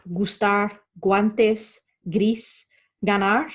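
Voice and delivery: G as in English "go" in gustar, guantes, gris, ganar; Spanish words gustar, guantes, gris, ganar opening on a hard G. Shown as a demonstration. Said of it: "It can be a hard G".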